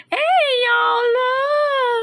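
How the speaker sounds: long, high-pitched cry of amazement; real, overwhelmed high exclamation; overjoyed wail. Real, not acted. Overwhelmed by the good news.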